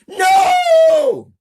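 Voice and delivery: terrified scream, extremely high and loud; piercing high wail of refusal; yelling. A narrator's reading. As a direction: scream it for your life. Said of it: "Amber yells".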